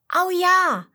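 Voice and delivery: high, loud burst of delight; excited cry. Acted. Tiger cub cheering at Papa's idea, excited and loud. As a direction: cheer it like a thrilled little cub. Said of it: "rief das Tigerkind begeistert".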